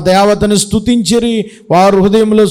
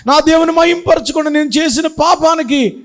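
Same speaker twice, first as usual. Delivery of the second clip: higher, pleading lament in regret; remorseful lament; 8 semitones higher than usual. Acted. Samson in the temple laments to his God, high remorseful wail. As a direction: wail it like a man sorry for his sin. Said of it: "ఏడుస్తున్నాడు".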